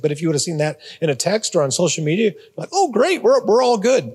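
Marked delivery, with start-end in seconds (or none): 2.7-4.1 high, sing-song mock cheer; pitched way up in sarcastic delight. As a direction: drip fake cheer over it. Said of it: "you know they're being sarcastic".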